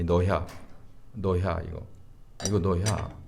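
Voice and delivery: low-pitched Chungcheong dialect phrase. Shown as a demonstration. Pastor repeating it for puzzled listeners, Chungcheong dialect. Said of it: "충청도 사투리로".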